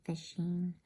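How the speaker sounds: ordinary delivery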